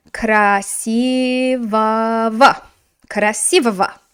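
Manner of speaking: красивого spelled out slowly in full; slow model word. Shown as a demonstration. Teacher models the adjective ending for class, slowly. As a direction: carefully sound out красивого. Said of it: "It's gonna go to".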